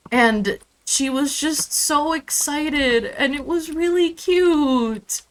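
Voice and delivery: ordinary talk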